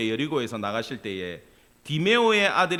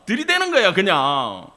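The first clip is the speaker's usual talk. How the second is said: catchphrase exhortation; 3 semitones higher, 7 dB louder than usual. Acted. Preacher urging congregation with a singer's catchphrase, loud and hammed-up.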